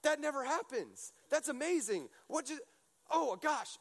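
high, excited squeal of disbelief; freaking out. Retold or imitated. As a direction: gush like you can't believe what you just saw. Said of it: "you start freaking out 'cause you're like".

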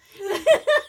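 bursting out laughing; big high laugh; loud laugh. Real, not acted. Laughing at joke.

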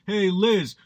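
a hint of the drunk best man ribbing the bride; best man teasing the bride Liz at a wedding, mildly leering; crude best man jab. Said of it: "He was like".

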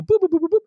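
high, quick phone-keypad boops; high-pitched dialing noises; imitated dialing beeps. Imitates dialling a phone again.

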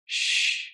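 soft, voiceless shush; hushing shh. Acted. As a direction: hush the wind and waves. Acting out Jesus hushing the storm.

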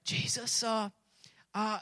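acting a timid follower, barely voiced; hushed, hesitant stammer. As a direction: whisper it meekly and falter. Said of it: "were a little bit timid".

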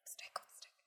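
hushed whisper, urgent; whispered warning. Retold or imitated. Daughter hushing her excited mother in a whisper. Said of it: "She's like".

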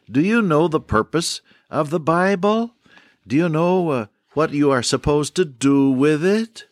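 put-on whimsical old doctor. Doctor quizzing his listeners about the Bible, whimsical and high. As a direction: playfully quiz the audience as the doctor. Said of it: "he would say in his whimsical fashion".

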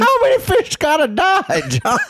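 high, laughing, put-upon host scolding a guest; disgruntled host voice. Acted. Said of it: "That's my disgruntled fucking host voice".

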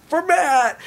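high, bright shout-out; cheerful exclaim. Retold or imitated. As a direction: happily announce the dedication. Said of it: "my friend Matt".

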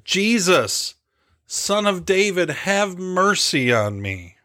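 restrained shout of a desperate beggar, ending slowly; insistent pleading shout. Acted. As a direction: call out high, slowing on the final plea. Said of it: "he shouted even louder".

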